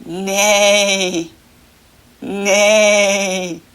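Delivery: whinny like a horse; horse neigh. Shown as a demonstration. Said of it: "what does a horse say?".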